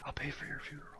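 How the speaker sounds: barely audible whispered offer; hushed, apologetic assassin, played for laughs. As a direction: whisper it as a sheepish killer. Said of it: "you hear him whisper".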